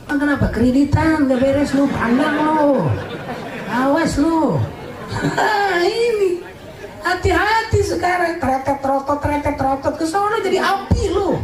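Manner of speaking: high, teasing scold of a sinner in the fire; mock scolding voice. Preacher mocks a debtor who won't pay, high teasing scold. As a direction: wag a finger at the debtor, half laughing.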